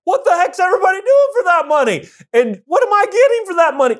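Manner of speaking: high-pitched, quick mock outrage; exasperated boss. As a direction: demand answers like a boss stunned by payroll. Voices boss fretting over payroll.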